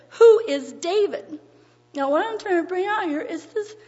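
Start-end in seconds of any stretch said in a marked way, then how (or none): 0.1-1.4 loud, slow scornful question; railing. Acted. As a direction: read it as a railing rebuff, pitched up. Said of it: "this man railed on him".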